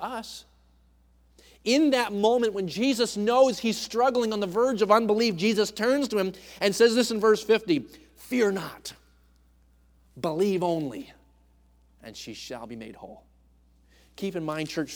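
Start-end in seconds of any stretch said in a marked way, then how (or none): 1.6-11.1 loud, high preacher's fervour; preaching. Acted. Preacher driving the point home to church family, loud and high. 12.0-13.2 "and she shall be made whole" said soft and low; tender Jesus promise. Acted. Jesus quietly promising the father his daughter will be whole, soft and low.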